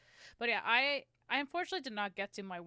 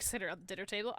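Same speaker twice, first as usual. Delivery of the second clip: ordinary talk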